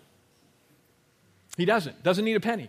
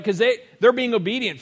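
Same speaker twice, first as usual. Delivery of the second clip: usual speaking voice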